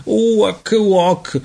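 put-on high-pitched baby croaks; gracidato. A narrator's reading. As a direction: squawk like a croaking infant. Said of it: "gracidò lui". (versi acuti gracidati)

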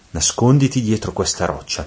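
plain reading voice

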